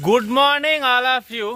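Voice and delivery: high, drawn-out morning call; called-out greeting. Real, not acted. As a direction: sing out a greeting to the whole group. Vlogger calls morning greeting to group. (అందరినీ పిలుస్తూ గట్టిగా పలకరింపు)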